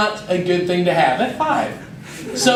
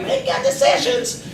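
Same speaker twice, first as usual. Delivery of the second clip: high, mock mom voice; lightly mock a nagging mom; a high falsetto mom saying make good decisions; 4 dB quieter than usual. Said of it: "Maybe a mom, like".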